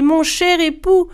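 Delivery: wife pleading desperately as the sun burns; crying out. A narrator's reading. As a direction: cry out to her husband in pain. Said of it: "se mit à crier".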